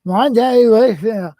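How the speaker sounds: talking with tongue held; garbled, tongue pinched between fingers; holding tongue with fingers. Shown as a demonstration. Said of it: "hold your tongue and say".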